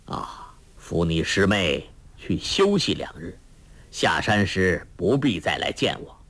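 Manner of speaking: low, hesitant elder's voice; weary old monk. A narrator's reading. Monk sending the young pair away, weary and low. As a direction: speak low, with tired pauses, as an old master.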